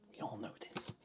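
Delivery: hushed conspiratorial aside; mock-secret whisper to the chat. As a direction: whisper it like a secret everyone knows. Whispered repeat of line 60.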